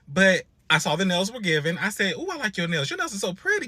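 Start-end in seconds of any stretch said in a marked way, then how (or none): 2.1-3.7 complimenting to break the ice. Retold or imitated. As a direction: coo the compliment to win her over. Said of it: "just to break the ice".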